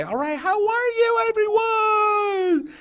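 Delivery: high, slow, stretched-out singsong introduction; crazy excited teacher. Shown as a demonstration. Teacher introducing herself to kindergarteners, exaggerated excitement. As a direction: draw out every word high for little kids. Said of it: "You know, something like that".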